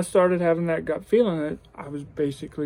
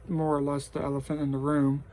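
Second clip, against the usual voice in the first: ordinary talk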